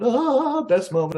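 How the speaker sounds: excited gasp. Retold or imitated. Reliving joy of Lucas's advice.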